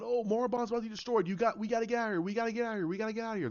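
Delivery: a hammed-up hurried alarm to flee; panicked urging. Panicked escape call, retold.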